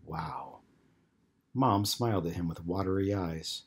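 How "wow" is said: very soft, low utterance; hushed, lower-pitched reaction; barely audible. A narrator's reading. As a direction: let it out under your breath, barely heard. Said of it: "uttered a barely audible".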